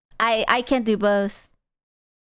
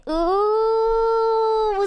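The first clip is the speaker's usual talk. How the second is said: long high "ooh"; put-on high coo, held out; teasing drawn-out squeal; mock swooning ooh; 9 semitones higher than usual. Mock swooning at being yelled at.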